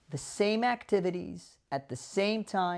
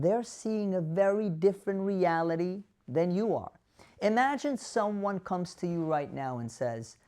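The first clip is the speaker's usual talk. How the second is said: ordinary talk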